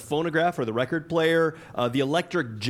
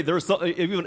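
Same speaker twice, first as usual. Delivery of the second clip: usual speaking voice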